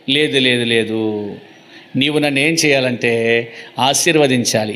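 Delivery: low-pitched mock plea; imitating a weeping man's refusal, understated; tearful pleading, acted. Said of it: "కన్నీళ్లతో పోరాడినాడంట".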